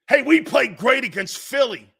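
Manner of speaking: loud mocking fan voice; mocking rival fan. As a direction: jeer it like a cocky fan.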